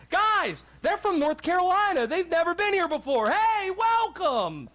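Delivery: imitating eager hosts, building from casual to very high-pitched; excited welcoming fans. Hometown fans welcoming out-of-town visitors to a tailgate, excitedly.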